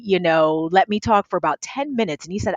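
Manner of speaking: ordinary talk, nothing marked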